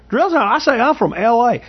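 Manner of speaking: slow, high Southern recruit answering in a drawl; Yates's Southern drawl. Retold or imitated. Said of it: "he talked with a Southern drawl".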